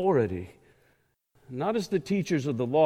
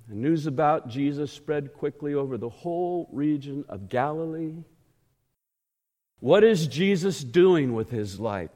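normal talking voice

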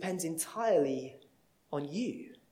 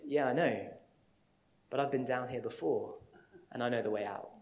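calm; 6 semitones lower, faster than usual. Acted. Friend calmly answering in the hole.

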